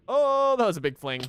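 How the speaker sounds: high, loud surprised shout. Real, not acted. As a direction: yelp at the big fling. Big jump in game.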